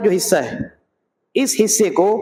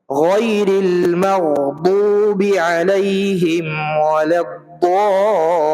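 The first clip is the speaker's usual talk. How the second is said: slow, drawn-out tajweed recitation; Quran recitation; much slower than usual. Shown as a demonstration. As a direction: recite it slowly and melodically. (آہستہ، کھینچ کر تلاوت)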